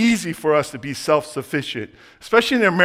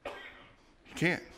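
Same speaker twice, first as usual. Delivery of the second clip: whispered; 19 dB quieter than usual. Real, not acted. Sermon point driven home softly.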